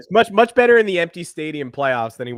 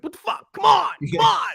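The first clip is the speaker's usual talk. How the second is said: imitating a frustrated pitcher. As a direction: act out a pitcher yelling in the dugout. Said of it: "banging on the dugout and being like".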